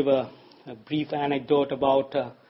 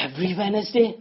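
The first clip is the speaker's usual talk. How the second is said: a slightly higher, puzzled question; mild disbelief; 4 semitones higher, faster than usual. Retold or imitated. Worker at the back questioning the deal, mildly incredulous. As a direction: ask it with a hint of complaint. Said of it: "spoke up from the back and said".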